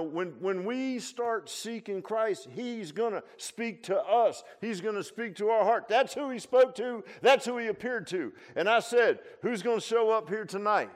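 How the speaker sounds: high, loud, building exhortation; forceful preaching. Real, not acted.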